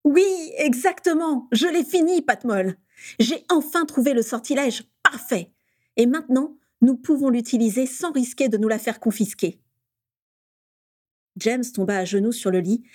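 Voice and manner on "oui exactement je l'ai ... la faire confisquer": bursting with joy. Acted. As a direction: explode with pride and delight. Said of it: "laissa pleinement éclater sa joie".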